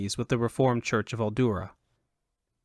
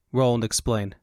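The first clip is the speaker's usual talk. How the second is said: ordinary delivery, nothing marked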